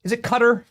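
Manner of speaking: Qatar said like "cutter", stress up front; questioning "cutter" for Qatar; trying a pronunciation. Shown as a demonstration. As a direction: ask Qatar as KUH-ter, high-pitched. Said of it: "I know I say it wrong".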